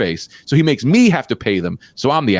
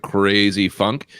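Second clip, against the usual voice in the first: normal talking voice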